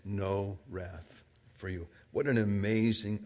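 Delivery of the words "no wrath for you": gentle Jesus. Acted. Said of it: "But Jesus says to you".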